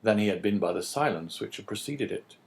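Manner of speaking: plain reading voice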